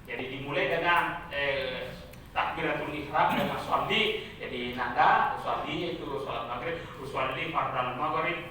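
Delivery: low, softened teacher voice; religion teacher instructing. Acted. Teacher coaching pupils for a prayer contest, measured instruction. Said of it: "guru itu mengatakan begini".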